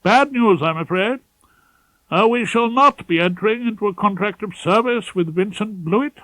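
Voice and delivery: a nervous pompous senior barrister with urgent news; fussy, pompous head of chambers. Acted. Ballard's voice.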